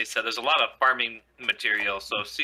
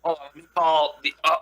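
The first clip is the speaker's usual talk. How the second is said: usual speaking voice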